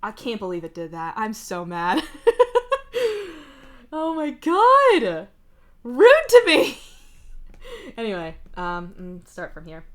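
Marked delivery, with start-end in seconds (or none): 2.1-3.9 high, loud laughing fit; burst of laughter. Real, not acted. Laughing at the outage. 3.9-6.8 slow, much higher, loud mock indignation; laughing outrage. Real, not acted. 6.8-7.9 breathless laugh. Real, not acted. Laughing at the outage.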